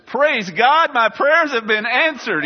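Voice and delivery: high, loud mock cry of praise; jubilant parrot outburst. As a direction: burst out as an overjoyed parrot. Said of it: "pastor's parrot let out this".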